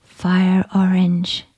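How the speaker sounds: ordinary delivery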